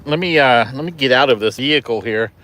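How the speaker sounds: ordinary talk, nothing marked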